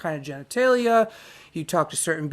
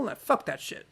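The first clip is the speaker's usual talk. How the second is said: usual speaking voice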